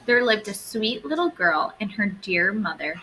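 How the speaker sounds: usual speaking voice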